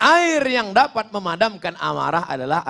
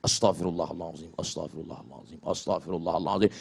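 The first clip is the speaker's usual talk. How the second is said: much lower and far softer murmured astagfirullahaladzim; calm yourself with a hushed repeated prayer; soft dhikr; 14 semitones lower, 10 dB quieter than usual. Shown as a demonstration.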